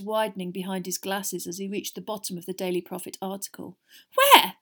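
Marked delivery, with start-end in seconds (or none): 4.1-4.6 very high, loud startled splutter. A narrator's reading. Mother choking on her tea, spluttering in alarm. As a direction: burst out in shock. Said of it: "spluttered Missus Weasley".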